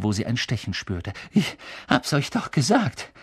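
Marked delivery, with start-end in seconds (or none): 1.3-3.1 winded and higher, stressing the repeat; gasping, insistent. A narrator's reading. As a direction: pant it out, rising on gesagt. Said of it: "keuchte Hermine".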